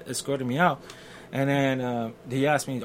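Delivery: normal talking voice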